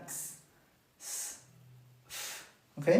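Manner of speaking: "x", "s", "f" whispered with no voice; voiceless sounds. Shown as a demonstration. Teacher running through the voiceless sounds. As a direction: breathe out each consonant voicelessly. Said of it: "muy similar a esta".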